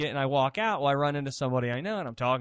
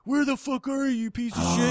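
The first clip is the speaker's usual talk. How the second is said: high angry mock yell; 10 semitones higher than usual. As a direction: yell down the phone. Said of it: "And I'm like".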